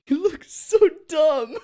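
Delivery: high, slow words squeezed through real laughing; helpless laughter. Real, not acted. Creator seeing the deformed clay prop, cracking up. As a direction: crack up while talking.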